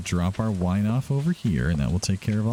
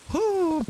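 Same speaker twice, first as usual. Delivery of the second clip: laughing whew. Real, not acted. Laughing relief.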